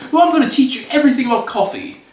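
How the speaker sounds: high, quick British impression; hammed-up British coffee expert; James Hoffman. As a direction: put on an eager, high-pitched British voice. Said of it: "comes to me in his British voice".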